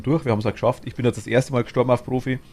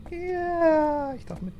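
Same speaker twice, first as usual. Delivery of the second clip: squeaky high voice; much slower than usual. Pretend. Playful squeaky voice in game.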